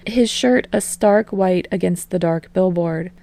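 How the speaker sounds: ordinary delivery, nothing marked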